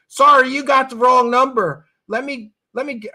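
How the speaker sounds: hammed-up receptionist passing the call along; mock phone brush-off. Nick Pope brushing off UFO callers on phone, mock dismissive. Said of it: "Nick Pope told people when they were calling".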